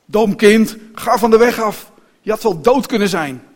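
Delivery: loud, scolding coachman's shout; calling out. A narrator's reading. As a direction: yell at a child in the road. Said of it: "De man riep". (luid en bestraffend roepen)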